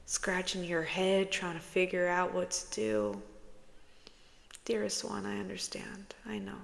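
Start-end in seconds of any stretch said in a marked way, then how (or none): 4.7-6.6 soft whispered comfort; hushed tender whisper. Real, not acted. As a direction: whisper reassurance to the viewer. Tender reading to viewer.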